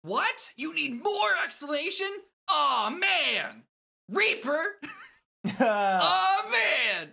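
very high, loud mock dismay; over-the-top squeaky exasperation; exasperated game character. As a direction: wail it up high, hammed-up. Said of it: "I hope my neighbors can hear me".

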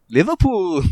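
a light Liverpool lilt on one word; mock Liverpool accent. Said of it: "he'd just go".

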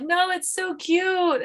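high, drawn-out plea for a cute idea; pleading protest. Retold or imitated. Wife to husband who vetoed the twin names, high pleading protest.

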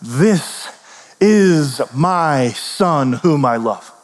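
very slow, solemn divine declaration; voice from the cloud. Acted. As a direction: draw out every word like God speaking. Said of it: "a voice came from the cloud and said".

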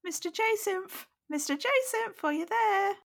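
put-on very high, squeaky small child calling up the stairs; eager little boy's voice far above the narrator's own; weird little boy voice. A narrator's reading. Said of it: "giving Ronnie a really weird voice".

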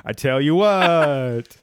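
slow, drawn-out vampire with a King of the Hill twang; hammed-up vampire hillbilly accent, stretched out. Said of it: "my vampire King of the Hill accent".

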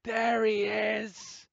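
high, drawn-out imitated holler; very loud call. Retelling the cosplay organizer spotting Adam, loud mock holler. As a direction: call him out across a hall, stretched long. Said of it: "she is very loud as well".

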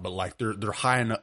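normal talking voice

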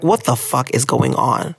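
usual speaking voice

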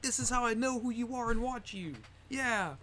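excited fan voice. Retold or imitated. Fans showing him the app.

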